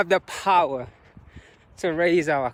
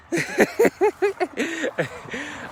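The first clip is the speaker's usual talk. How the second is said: long, high giggle; real laugh; 13 semitones higher than usual. Real, not acted. Runner glad of an excuse to slow down, high laugh. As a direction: giggle freely, high and drawn out.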